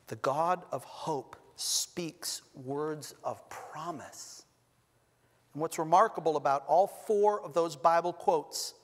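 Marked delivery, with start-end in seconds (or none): 0.1-4.5 solemn. Real, not acted. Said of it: "solemn restatement".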